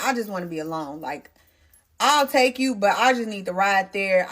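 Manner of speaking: normal talking voice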